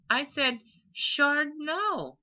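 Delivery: little one puzzled; confused repeat. A narrator's reading. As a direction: innocently say it again. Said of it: "the Ember repeated in confusion".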